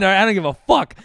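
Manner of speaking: loud, high-pitched defiance; brazen outburst. Real, not acted. Comedian owning his bedroom story live on air, brazen outburst. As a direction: burst out with proud defiance.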